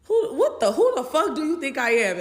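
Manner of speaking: high, loud indignant yelling; shrill furious retold yell; outraged shout. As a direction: blow up at him in disbelief. Confronting him in hotel.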